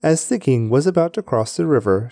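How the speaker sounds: usual speaking voice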